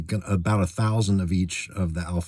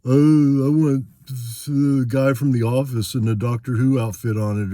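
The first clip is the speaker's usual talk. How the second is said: much higher, louder mock-whiny voice; mocking the alterer; 5 semitones higher, 3 dB louder than usual. Mocking collectors who ruin alpha cards, higher whiny mimicry. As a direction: sneer at him with a squeaky put-on voice. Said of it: "And you're just like".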